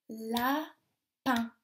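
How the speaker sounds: LAPIN as a long la, pause, pin; split into syllables. Shown as a demonstration. Teacher gives children a word, LAPIN split in two syllables. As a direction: break LAPIN into two separate beats. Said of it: "Il y a deux syllabes".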